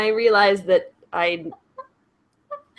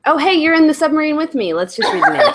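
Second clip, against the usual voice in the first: excited; 9 semitones higher, 5 dB louder, faster than usual. Real, not acted. Excited, playful submarine bit.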